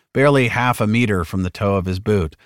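usual speaking voice

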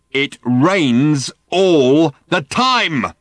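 very slow, high, booming shout; raving. A narrator's reading. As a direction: bellow it word by word, like thumping a table. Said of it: "raved the man".

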